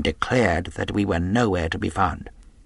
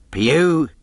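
loud, raised call; high hailing shout; cried out; 6 semitones higher, 5 dB louder than usual. A narrator's reading. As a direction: call down to someone in the road. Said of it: "he cried".

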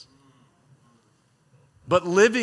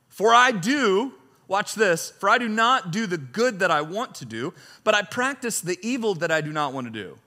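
raised, declaiming reading voice; scripture reading; 7 semitones higher, 6 dB louder than usual. Acted. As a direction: read Paul's words like a proclamation. Reads scripture aloud with force.

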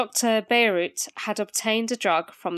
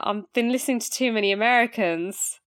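usual speaking voice

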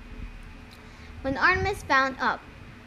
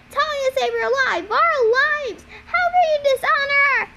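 high, loud, mounting indignation; furious outburst; 13 semitones higher, 6 dB louder than usual. A narrator's reading. Annabeth berating Luke for betraying Talia, furious outburst. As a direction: build to a high, rushed shout of outrage. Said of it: "Annabeth sounded so angry".

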